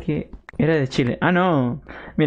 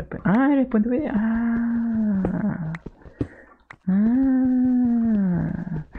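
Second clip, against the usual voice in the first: high surprised; 8 semitones higher, much slower than usual. Real, not acted. Surprised at viewer's district.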